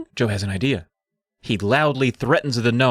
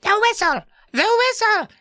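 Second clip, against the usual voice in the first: very high, squeaky mock yell; gnomes yelling. Gnomes alarmed as the whistle comes out, squeaky yell. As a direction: shriek it in a tiny gnome voice. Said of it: "all of the gnomes yell".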